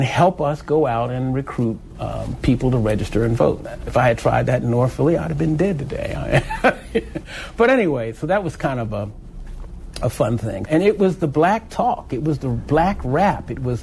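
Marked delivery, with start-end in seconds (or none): 6.5-7.5 short chuckle after a grim joke; laughing. Real, not acted. Storyteller joking he'd have been dead, chuckling. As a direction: chuckle lightly at your own remark.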